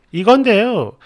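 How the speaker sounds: ordinary talk, nothing marked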